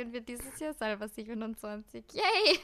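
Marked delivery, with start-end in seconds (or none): 2.1-2.6 mock cheer. Real, not acted. Mock cheer about own age.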